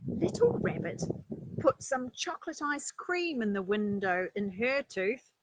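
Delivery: ordinary delivery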